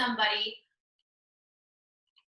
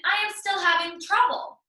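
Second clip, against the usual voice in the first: teacher; 7 semitones higher than usual. Acted. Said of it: "says to her student".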